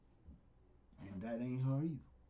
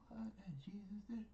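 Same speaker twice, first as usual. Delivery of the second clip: whispered shock, much softer; startled question; 7 semitones higher, 10 dB quieter than usual. Retold or imitated. Preacher retells his own surprise at Jesus, whispered. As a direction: gasp it out in a hushed whisper. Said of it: "I said".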